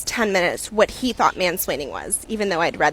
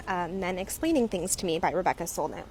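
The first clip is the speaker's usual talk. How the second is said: ordinary talk, nothing marked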